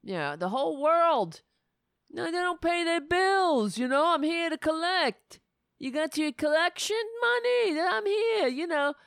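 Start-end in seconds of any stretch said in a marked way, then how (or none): 2.1-9.0 higher, put-on mobster swagger; mob collector voice. Mobster demanding payment from Germany, higher swaggering voice. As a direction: shake them down like a mob enforcer. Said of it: "It's like the mob".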